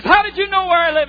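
very high, loud incredulous question; hammed-up shocked yelp of a question; astonished. Preacher voices a hitchhiker stunned his driver found his house, astonished.

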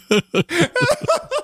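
squealing laughter; high laugh. Real, not acted. Laughing at story.

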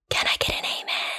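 breathy, hushed church-style call for an amen; whispered amen call. Real, not acted. Whispered to her listeners, asking for an amen. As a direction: lean in and whisper it like a preacher's aside.

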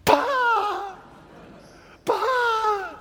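high, loud mimicking sputter; mocking pah. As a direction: copy the gasp to tease. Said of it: "mimicking me in front of me".

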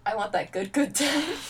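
lifting high and far on the second good, hammed up; mock dramatic wish. Joking about a character craving death, hammed-up high longing.

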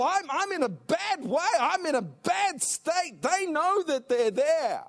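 loud, high, rising preacher's cry mimicking desperate sinners; full-force preaching. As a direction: preach it hard, pleading for the lost.

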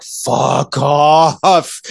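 drawn-out, exasperated outburst; exasperated anger. Real, not acted. Reviewer fed up with the movie's lies, exasperated anger. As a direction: stretch both words out in fed-up disbelief. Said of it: "I'm getting so mad at this movie".

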